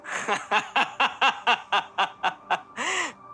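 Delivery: high villain cackle, falling away; maniacal laugh. Acted. Abu Lulu cackles madly at the escaping Anmol. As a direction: cackle like a madman. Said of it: "पागलों की तरह हंसते हुए कहता है".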